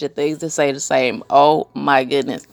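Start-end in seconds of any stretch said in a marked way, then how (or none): none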